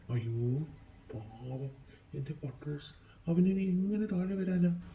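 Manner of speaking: hushed, sorrowful worry; grieving lament. Acted. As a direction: lament softly for someone lost. Sister laments her lost kite.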